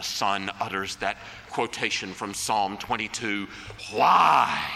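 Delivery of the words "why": sudden loud cry of "Why?"; anguished cry. Retold or imitated. Preacher voicing Christ's cry from the cross, anguished.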